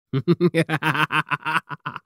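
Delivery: a short, pleased laugh; delighted laugh. Acted. Pirate captain laughing fondly at his queen. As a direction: laugh with delight at her eagerness.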